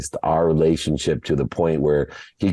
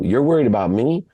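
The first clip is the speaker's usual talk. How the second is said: higher-pitched disbelieving question; retold disbelief, pitched up; incredulous retort; 7 semitones higher than usual. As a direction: throw the worry back at him, incredulous. Son retorts to worried father.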